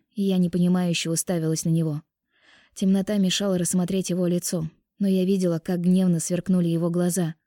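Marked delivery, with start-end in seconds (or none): none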